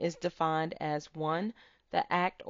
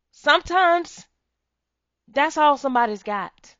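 high, loud preaching; preaching at full force; 8 semitones higher, 7 dB louder than usual. Real, not acted. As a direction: let the sermon peak. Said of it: "preaching climax".

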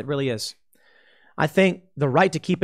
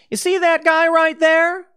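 mock politician voice; 10 semitones higher, 4 dB louder than usual. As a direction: ham it up as a scheming prosecutor. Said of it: "Kamala Harris walks up to you and says".

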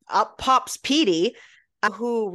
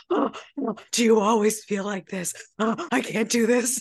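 overwhelmed; 2 semitones higher than usual. Retold or imitated. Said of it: "it was so much for him".

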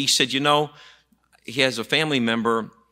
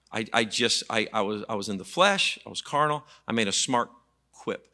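ordinary talk, nothing marked

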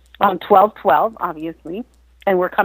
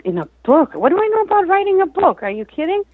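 retold disbelief, pitch leaping high; incredulous; 11 semitones higher than usual. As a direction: balk at an absurd suggestion. Retold reaction to book suggestion.

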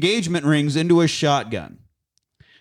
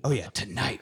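whispered; 4 semitones lower, 8 dB quieter than usual. Real, not acted. Unvoiced whisper.

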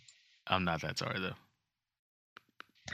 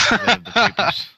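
big, loud, higher news anchor flourish; hammed-up newscaster handoff; mock anchor sign-off; 10 semitones higher, 8 dB louder than usual. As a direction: announce it like the anchor wrapping up. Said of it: "I'm gonna tap the paper now".

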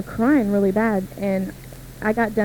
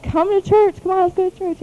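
much higher, bright coaxing call; eager invitation; 10 semitones higher than usual. Retold or imitated. Girl urging her friends to church, eager and high. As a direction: urge your friends to come, eager and pitched way up. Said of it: "I said".

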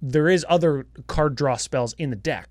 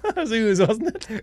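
ooze voice; 7 semitones higher than usual. Pretend. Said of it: "that's my ooze voice".

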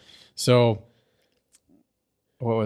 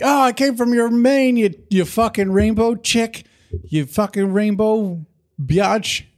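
much higher, squealing taunt; mocking accuser; 10 semitones higher than usual. Host mocks Twilight accusing Rainbow Dash, high squealing taunt. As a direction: sneer it up in a shrill cartoon squeal. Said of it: "She's like".

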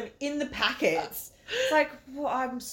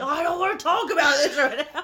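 loud, high-pitched outburst; hammed-up exasperated yell; losing it; 5 semitones higher, 5 dB louder than usual. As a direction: retell it as a cracked-it tantrum. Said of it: "cracks the shits. He, like, loses it".

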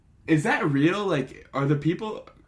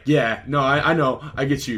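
higher, louder mock concession; placating boyfriend; 5 semitones higher, 8 dB louder than usual. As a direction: quickly agree to head off the complaint. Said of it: "And he's like".

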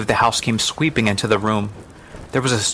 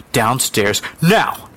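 stern order; 2 semitones higher, 3 dB louder, much slower than usual. Acted. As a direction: order the child out. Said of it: "she ordered".

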